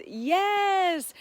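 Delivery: high, loud burst of agreement; thrilled shout of yes; excited yes. Real, not acted. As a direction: blurt it out with delight. Excited reply to viewer comment.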